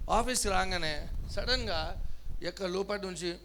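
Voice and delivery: ordinary talk, nothing marked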